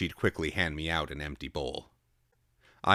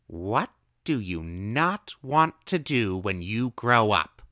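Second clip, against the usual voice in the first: slow, drawn-out question; old woman asking; 6 semitones higher, 6 dB louder, slower than usual. A narrator's reading. Old woman slowly asking the boy her odd question. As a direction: ask it slowly like a riddle.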